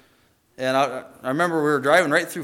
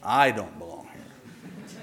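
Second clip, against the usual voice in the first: mocking repeat; 3 semitones lower, 5 dB quieter, slower than usual. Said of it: "And then I said".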